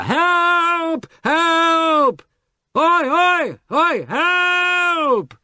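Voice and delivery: very high, loud desperate yells; shouting for help. A narrator's reading. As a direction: bellow for rescue across the marsh. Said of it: "both shouted together".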